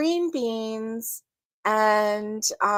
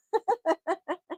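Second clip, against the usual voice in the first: soft laugh; 7 semitones higher, 6 dB quieter than usual. Real, not acted.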